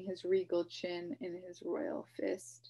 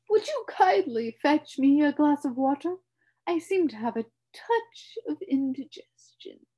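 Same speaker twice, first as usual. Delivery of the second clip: high, loud, distressed humbug plea; mild gasping; 8 semitones higher, 10 dB louder than usual. A narrator's reading. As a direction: ask for water, a touch of gasping. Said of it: "turned to Milo and gasped".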